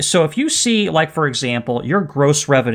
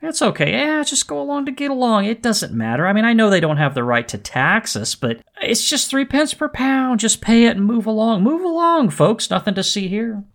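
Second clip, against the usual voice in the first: mock shrug-it-off voice, pitched up; shrugging indifference; 7 semitones higher than usual. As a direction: wave it all away as no big deal. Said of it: "shrug their shoulders and say".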